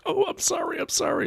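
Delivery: high, meek mock apology; sheepish apology. Raging friend apologising to the old lady, high and meek. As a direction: play the chastened friend backing down. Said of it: "he's like".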